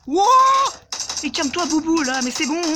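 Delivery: high, loud pleading shout; panicked yell. Real, not acted. Gamer shouting at the charging Bouboule, panicked. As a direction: yell at the monster to back off.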